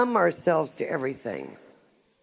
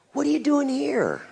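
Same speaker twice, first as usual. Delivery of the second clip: higher-pitched astonished question; mock surprise; 6 semitones higher than usual. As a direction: imitate a nosy stranger's surprise. Retold stranger surprised to see her.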